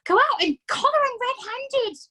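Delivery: wife pushing husband to act, urgent; urgent mother. A narrator's reading. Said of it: "hissed the mother".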